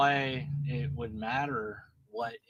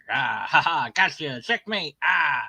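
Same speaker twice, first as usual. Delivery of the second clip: mock gloating. Mock triumph parodying opponent.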